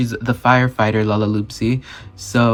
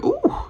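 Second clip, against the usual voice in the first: very high-pitched impressed squeal; high "ooh". Real, not acted. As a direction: let out a high little "ooh". Teasing reaction to fiery temper.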